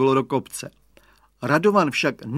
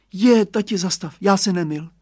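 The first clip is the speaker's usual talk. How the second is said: put-on higher, louder boy's cry; child calling; 6 semitones higher, 5 dB louder than usual. A narrator's reading. Radovan calling to father to stop the cart, higher and louder. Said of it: "zvolal Radovan".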